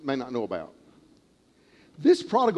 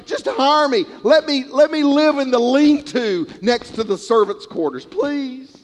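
pleading son; 6 semitones higher, 3 dB louder than usual. Retold or imitated. Prodigal son voiced planning return.

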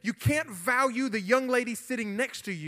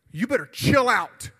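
loud retold warning to back off; raised voice, squaring up to someone; punchy loud challenge on "chill out"; retold confrontation; 3 semitones higher, 7 dB louder than usual. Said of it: "and said".

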